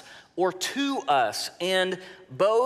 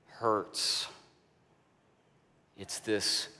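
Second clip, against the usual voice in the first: ordinary talk, nothing marked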